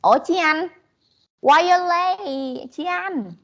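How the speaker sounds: mock-stern, singsong call across the room; teacher calls out to a late student, teasing. Real, not acted.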